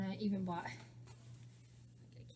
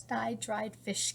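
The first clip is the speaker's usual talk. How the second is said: usual speaking voice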